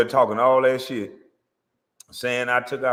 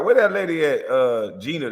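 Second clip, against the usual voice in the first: usual speaking voice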